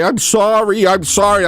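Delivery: high-pitched mock apology; pleading underling. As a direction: play a cowed employee begging pardon. Imagines Ozzy apologising to the boss.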